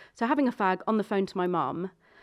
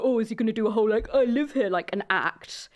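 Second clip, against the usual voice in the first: mock inner worry; 4 dB louder than usual. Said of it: "there's always acting involved".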